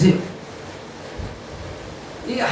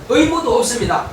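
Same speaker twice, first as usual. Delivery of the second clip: normal talking voice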